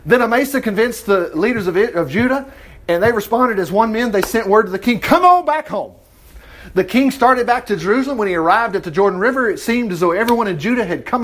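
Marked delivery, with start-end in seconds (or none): 5.0-5.9 raised, higher call; big rallying holler. Acted. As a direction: call him home, voice lifted. Acted message calling king home.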